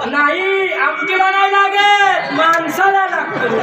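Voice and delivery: high, drawn-out proclamation; ringing, stretched-out correction to a crowd; emphatic shouted answer. Shown as a demonstration. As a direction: call the answer out slowly and high.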